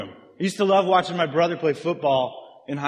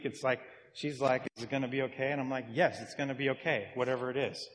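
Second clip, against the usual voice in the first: soft retold chat; 9 dB quieter, faster than usual. Retelling talks with his wife.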